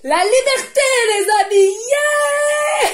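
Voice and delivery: high, loud euphoric cry; excited shout. Real, not acted. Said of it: "je m'excite".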